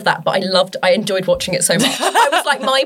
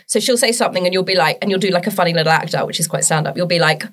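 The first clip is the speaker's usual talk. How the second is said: ordinary talk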